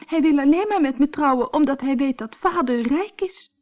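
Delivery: put-on high girlish voice, upset; high, unhappy girl; young Colombine. Colombine's voice held.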